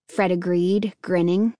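ordinary delivery

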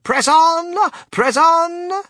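high, drawn-out rallying cry; shouting. A narrator's reading. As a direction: shout them onward. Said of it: "shouted Mister Wonka".

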